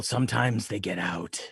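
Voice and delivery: whispered aside; whispering. Acted. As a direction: whisper it out of the side of your mouth. Aside about mouth full.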